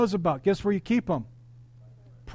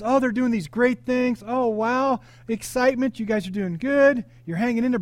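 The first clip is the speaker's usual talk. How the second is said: gushing praise; 5 semitones higher, 4 dB louder than usual. Acted. Preacher voices praise for the Ephesus church, gushing. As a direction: gush over their great works in a raised voice.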